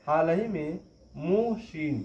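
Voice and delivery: ordinary talk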